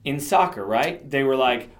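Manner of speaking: normal talking voice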